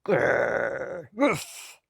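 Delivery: low growling grunt then a woof; yak grunt. A narrator's reading. Yak grunting as he tosses Rabbit. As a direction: grunt like a big shaggy yak. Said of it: "with a grunt".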